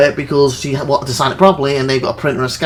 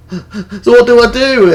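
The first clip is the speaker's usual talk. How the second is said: slow, higher plea through laughter; helpless laughing; 8 semitones higher, 5 dB louder, slower than usual. Retold or imitated. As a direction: laugh helplessly as you ask it. Laughing over his retold predicament.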